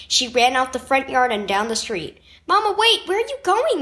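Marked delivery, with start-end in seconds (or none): 2.5-3.8 high, urgent call after someone; shouting. A narrator's reading. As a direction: call out to Mama running away. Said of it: "Miguel shouted".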